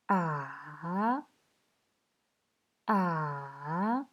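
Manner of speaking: "A" dipping low, then climbing back up; third tone. Shown as a demonstration. Teacher demonstrating Mandarin tones, "A" falling then rising. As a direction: draw out "A" down and up again. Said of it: "ça descend et ça remonte".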